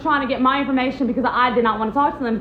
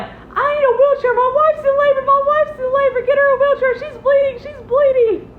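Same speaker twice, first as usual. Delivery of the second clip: high, loud frantic yelling for help; frantic husband bursting into the hospital, shouting in panic; panicked shouting; 12 semitones higher, 5 dB louder than usual. Retold or imitated. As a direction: shout for a wheelchair like a terrified husband. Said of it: "that's when he started freaking out".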